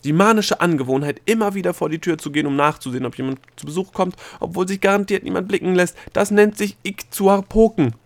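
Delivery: shrill, breathless explanation; hysterical lecture. A narrator's reading. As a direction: rattle it off in a hysterical rush. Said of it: "Delias hysterischen Vortrag".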